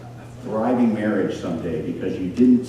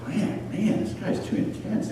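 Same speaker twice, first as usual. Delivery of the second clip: muttered complaint; 10 semitones higher, 7 dB quieter than usual. Retold or imitated. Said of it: "He's like".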